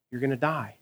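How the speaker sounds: usual speaking voice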